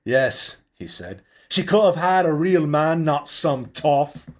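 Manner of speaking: grudging contempt for the rival; bitter Charlie. Acted. Charlie bitter about wedding.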